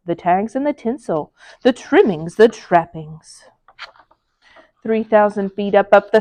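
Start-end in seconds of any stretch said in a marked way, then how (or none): none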